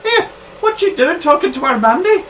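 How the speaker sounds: high-pitched challenge; jealous confrontation. Acted. Local confronting stranger talking to Mandy, high indignant challenge.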